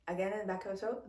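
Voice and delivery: ordinary delivery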